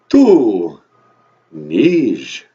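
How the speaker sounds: low, loud vampire count. Acted. Count says the English numbers for the host, booming vampire voice. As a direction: announce the number like a theatrical vampire.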